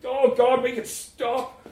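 very high, loud cry of distress; anguished shout. A narrator's reading. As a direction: cry out, unable to bear it. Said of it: "shouted Cian".

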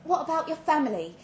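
a touch of alarmed urgency; anxious. Acted. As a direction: press him, heart thumping. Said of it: "My heart was thumping".

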